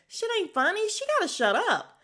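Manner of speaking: high-pitched mock outrage; irritated scolding. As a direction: snap at the laughing dad. Annoyed viewer scolding the screen.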